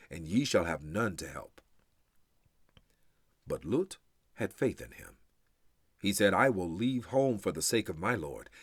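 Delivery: ordinary talk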